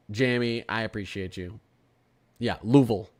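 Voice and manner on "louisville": Louisville as LOO-vul, two syllables; Louisville with the middle swallowed; Kentucky pronunciation. Shown as a demonstration. Streamer agreeing on the local name, Kentucky pronunciation. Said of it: "Kentucky's called Louisville".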